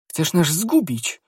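high, drawn-out alarmed cry; alarmed protest. A narrator's reading. Wife alarmed, stopping his rash attack.

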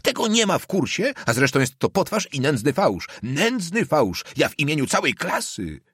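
high, indignant outcry, volume restrained; shouted protest. A narrator's reading. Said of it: "krzyknął na cały głos". (oburzony, wysoki okrzyk)

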